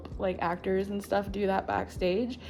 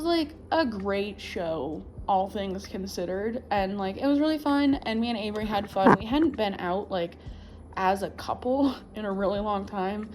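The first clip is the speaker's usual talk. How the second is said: usual speaking voice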